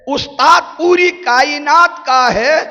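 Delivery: high, loud sermon peak; fiery preaching. Acted. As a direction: thunder it out to the crowd. Preaching at full force.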